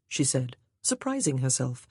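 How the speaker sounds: usual speaking voice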